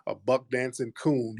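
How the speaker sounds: usual speaking voice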